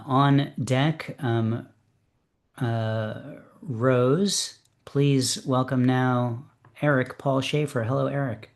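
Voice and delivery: ordinary delivery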